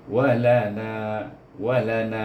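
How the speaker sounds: wa lana with long na; over enunciating. Shown as a demonstration. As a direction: stretch the na in wa lana. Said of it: "panjangnya empat atau lima harkah".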